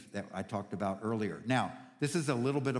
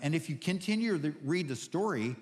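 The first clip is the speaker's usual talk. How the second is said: preaching with fervor; 5 semitones higher than usual. Acted. Pastor rallying the church about God in the cave, fervent preaching. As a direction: rouse the congregation.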